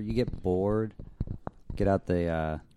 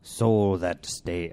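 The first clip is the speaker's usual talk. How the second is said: put-on slow, louder old sea-dog curse; ominous pirate warning; 3 semitones higher, 5 dB louder, much slower than usual. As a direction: drag out a dire pirate warning.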